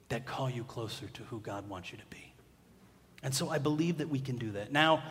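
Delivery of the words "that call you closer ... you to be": whisper. Acted. Whispered quote continuation.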